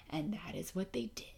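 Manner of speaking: sudden whisper. Real, not acted. Whispering her complaint to podcast listeners. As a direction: drop to a whisper at the end. Confiding about the vet's mistake.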